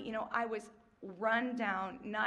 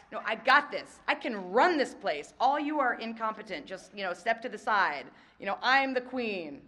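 loud, lordly bossing everyone aside; hammed-up know-it-all taking over; mock bossy queen; 8 dB louder than usual. Pushing coworkers aside as the self-appointed queen, loud and mock-bossy.

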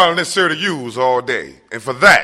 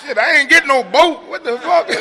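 laughing; 8 semitones higher than usual. Real, not acted. Jokes about smoking, laughing.

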